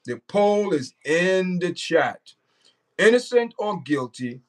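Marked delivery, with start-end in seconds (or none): 0.1-2.2 slow, each word spaced out for the chat; slow emphatic repeat. Shown as a demonstration. Streamer repeating to viewers where the poll is, slow and deliberate. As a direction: spell it out slowly so nobody misses it.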